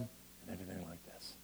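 ordinary delivery

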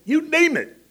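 high, loud cry; shouted. Acted. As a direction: hurl it out to the congregation. Preacher rising to a shout.

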